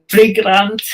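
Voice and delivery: high, loud deadpan quote of the price; quoting the tradesman. Retold or imitated. Retold neighbour quoting his price.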